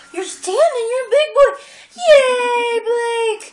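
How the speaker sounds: high, loud delighted praise; excited cheering. Real, not acted. Parent cheering the baby for standing up.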